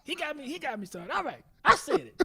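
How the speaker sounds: high, grudging mock admission. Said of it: "And [name] like".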